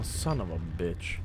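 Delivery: ordinary talk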